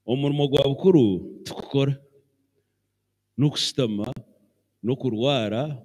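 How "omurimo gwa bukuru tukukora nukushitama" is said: usual speaking voice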